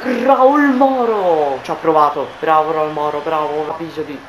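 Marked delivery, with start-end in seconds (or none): 0.0-1.6 long drawn-out excited shout; breathless italian goal commentary. Real, not acted. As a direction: hold the name, thrilled. Shot attempt, elongated shout.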